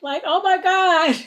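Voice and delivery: excited reaction. Real, not acted. Reacting to peppers scene.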